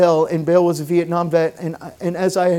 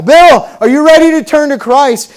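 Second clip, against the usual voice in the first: louder, higher call: "Bill, are you ready to turn to Christ?"; really loud; 8 semitones higher, 8 dB louder than usual. Retold or imitated. Pastor calling out to hard-of-hearing Bill, loud. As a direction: call it out so a deaf man hears. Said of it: "I had to say it really loud".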